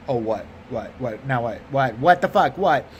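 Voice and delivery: short, exasperated repeated whats. Acted. Comic hearing bad news from the teller, exasperated own voice. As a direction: drop the polite act into irritated disbelief.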